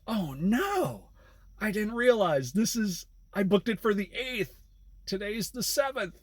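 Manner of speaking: high, fretful imitation of the passenger; panicked passenger. Passenger realizes wrong date, voiced.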